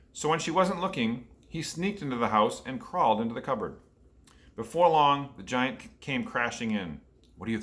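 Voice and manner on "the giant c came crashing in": ordinary delivery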